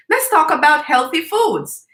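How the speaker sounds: lightly voiced niece suggesting a topic; child's voice. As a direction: say it like a proud five-year-old. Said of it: "And she said to me".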